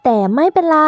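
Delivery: ordinary delivery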